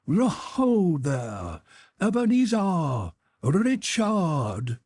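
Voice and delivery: put-on a rich, fat, jovial old master calling out; jovial Fezziwig voice. A narrator's reading. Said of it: "comfortable, oily, rich, fat, jovial voice".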